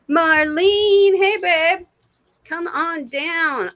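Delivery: bright high call to a friend. Real, not acted. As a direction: sing out her name like a welcome. Calling the next viewer in.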